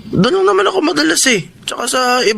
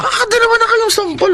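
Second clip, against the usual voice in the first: protesting; 8 semitones higher than usual. Acted. Son protests second slap.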